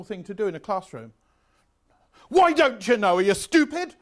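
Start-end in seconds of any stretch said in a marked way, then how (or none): 2.3-4.0 loud, high, scornful berating; angry teacher. Retold or imitated. Teacher Jones mocking a pupil who doesn't know, loud and scornful. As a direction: tear into the pupil with contempt.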